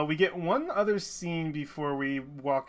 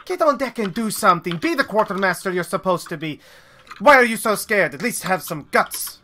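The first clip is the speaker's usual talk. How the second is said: higher, louder scolding of a scared brother; yelling scold; 5 semitones higher, 4 dB louder than usual. Acted. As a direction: berate him, demand he show guts. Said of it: "just yells and says".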